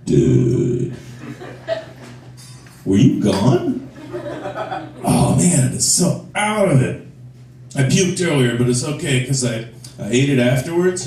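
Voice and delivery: slow, dazed stoner drawl; stoner cat. Acted. Cat greets owner from the floor, stoned. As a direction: play the cat as a spaced-out roommate. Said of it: "stoner roommate in college".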